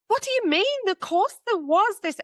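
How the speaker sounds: pleading disbelief against husband; disbelieving protest. Retold or imitated. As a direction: insist in disbelief. Insisting nurse was there.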